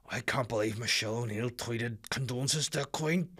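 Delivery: a lightly voiced annoyed caller, indignant; a hint of the outraged phone-in caller; ranting radio caller. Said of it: "you're like".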